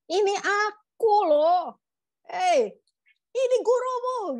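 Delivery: high, loud, drawn-out holler; insistent high-pitched acted shout; calling out. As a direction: call across the water to be recognised. Acts Jesus calling to disciples.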